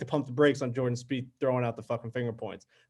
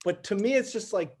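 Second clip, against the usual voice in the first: normal talking voice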